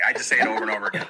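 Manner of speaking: normal talking voice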